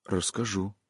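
soft hushed promise; whisper. A narrator's reading. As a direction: breathe it out quietly. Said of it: "прошептал Ордынов".

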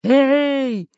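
long, high hailing call; booming drawn-out holler; thunderous shout. A narrator's reading. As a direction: bellow to someone far away. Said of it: "закричал громовым голосом Буль-Буль".

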